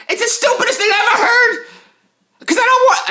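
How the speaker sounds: high, laughing disbelief; exasperated laughing rant. Real, not acted.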